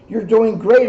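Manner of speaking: encouraging. Acted. The moon encouraging the first airplane, bright and loud. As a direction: cheer them on from above. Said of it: "encouraged the moon".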